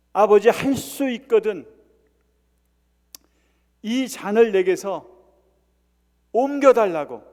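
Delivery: loud, high cry of prayer; fervent pleading. Acted. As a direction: beg the Father, loud and with long pauses. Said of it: "세 번씩이나 그렇게 간구했겠습니까".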